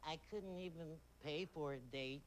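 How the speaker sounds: usual speaking voice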